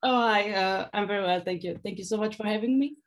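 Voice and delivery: ordinary delivery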